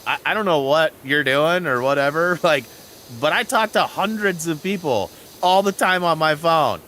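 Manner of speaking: usual speaking voice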